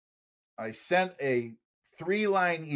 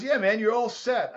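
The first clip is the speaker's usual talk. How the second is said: put-on Australian accent; 9 semitones higher than usual. Phoning late at night with the news, Australian accent. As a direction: announce it like a proud Aussie mate. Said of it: "this wild man talking to me".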